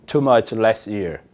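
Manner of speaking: slow, careful English; slow model sentences. Shown as a demonstration. Teacher reads affirmative examples slowly to class. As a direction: read them slowly for learners. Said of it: "o verbo no passado simples".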